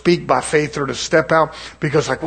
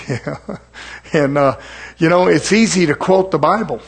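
ordinary delivery

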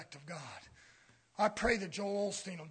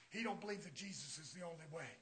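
normal talking voice

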